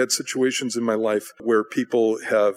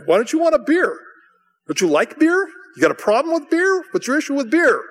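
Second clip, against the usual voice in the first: high, pushy pile-on of questions; mock badgering; 4 dB louder than usual. Brother pressing a guest to drink, badgering. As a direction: act out an incredulous heckler, pitched way up.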